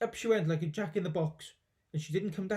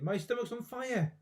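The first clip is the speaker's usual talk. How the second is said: panicked grandma; 7 semitones higher than usual. Acted.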